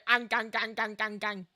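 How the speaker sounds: low, soft chomping noise, "ang, gang, gang"; dull, quiet mock gnashing; teeth-gnasher smile. Shown as a demonstration. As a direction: imitate gnashing teeth in a low repeated chant. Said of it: "where my smile is very".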